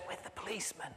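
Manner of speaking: unvoiced little boy whisper. Boy whispers answers to the puzzled boss. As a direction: barely breathe the words.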